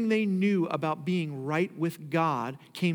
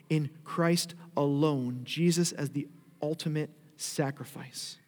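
ordinary delivery

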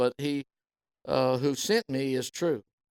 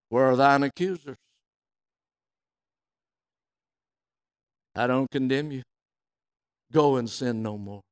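gentle. A narrator's reading. Jesus speaks gently to the woman.

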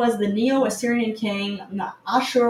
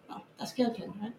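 ordinary talk

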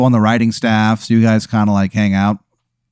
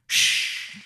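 unvoiced group shh; a hushed shh down the phone line; whisper; 11 dB quieter than usual. Bunch of guys on the line hush in a whisper.

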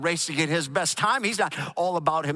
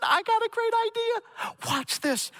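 excited; slower than usual. Retold or imitated. Excited friend reveals a plan.

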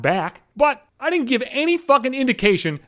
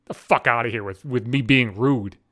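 ordinary talk, nothing marked

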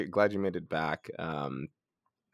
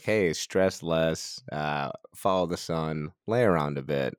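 slow laid-back advice. Retold or imitated. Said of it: "Be like".

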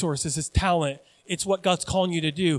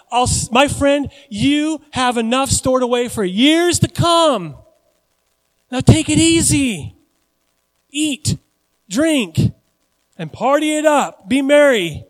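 high, loud, slow mock celebration; drawn-out, hammed-up self-congratulation; rich man congratulating himself; 7 semitones higher, 8 dB louder, slower than usual. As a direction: play the rich fool grandly telling himself to party. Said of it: "so he's like".

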